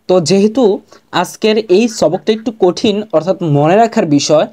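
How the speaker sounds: ordinary talk, nothing marked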